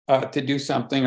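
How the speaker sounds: ordinary delivery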